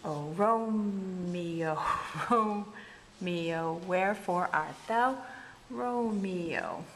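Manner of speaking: "Oh, Romeo" drawn out very slowly, foot by foot; syllables divided. Shown as a demonstration. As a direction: stretch the first syllable of "Romeo" and pause between feet. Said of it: "divide these syllables".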